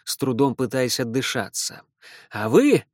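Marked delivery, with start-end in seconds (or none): 2.3-2.9 loud, bossy call; commanding. Acted. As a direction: order them sharply. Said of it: "он властно указал".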